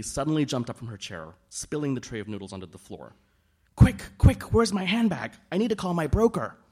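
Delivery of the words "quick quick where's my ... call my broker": much higher and louder, frantic; frantic panic. A narrator's reading. Daisy panics for her handbag to call her broker, frantic and shrill-loud. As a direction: scramble for the phone in panic.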